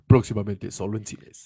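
low dramatic promo tag; movie trailer voice. Acted. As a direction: end it like a film ad. Joins the mock trailer.

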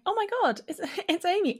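high-pitched retold delight; excited recognition. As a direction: spot a friend in the crowd. Retells spotting a friend in crowd.

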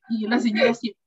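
ordinary talk

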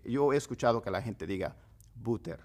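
ordinary talk, nothing marked

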